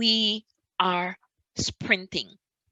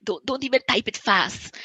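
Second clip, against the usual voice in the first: ordinary talk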